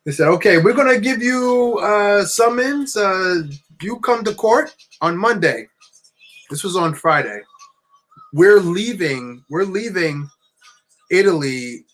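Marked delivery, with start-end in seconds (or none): 0.3-5.7 slow, high mock Italian officer; bad Italian accent. Officer handing out a summons, bad Italian accent. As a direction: spell it out slowly in a clumsy Italian accent. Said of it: "I'm doing a horrible impression".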